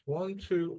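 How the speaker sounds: ordinary delivery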